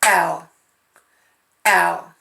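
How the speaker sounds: Al said short and punchy; teacher isolating the first syllable; clapped syllable. Shown as a demonstration. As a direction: clap out Al on its own. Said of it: "let's just clap the first one".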